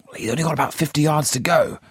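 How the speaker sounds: high, fast, strained excited call; harsh with excitement. A narrator's reading. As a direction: rush it out, nerves jangling. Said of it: "voice was harsh with excitement".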